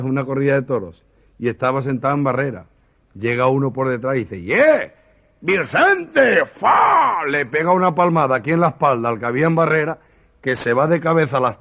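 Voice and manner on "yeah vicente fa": calling out. Acted. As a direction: bellow across the stands at an old pal. Man slaps friend at bullfight.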